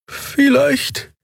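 high, slow plaintive complaint; high wailing young woman; Xia lamenting. A narrator's reading. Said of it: "klagte Xia".

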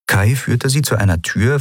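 ordinary delivery

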